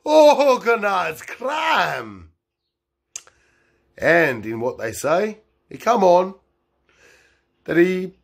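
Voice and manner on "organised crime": slow laughing shout, higher. Acted. The teller repeating the reveal, laughing shout. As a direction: belt "Organised crime!" slowly through a laugh.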